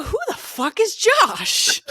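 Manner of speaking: high, loud, slow disbelieving yell; retold shocked yell. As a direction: blurt it out in total disbelief at the kid. Mom retells shock at her son.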